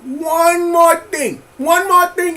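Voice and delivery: booming uncle voice, slow start. Acted. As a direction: lean into the loud uncle voice. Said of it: "in my uncle voice".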